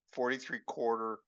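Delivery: ordinary talk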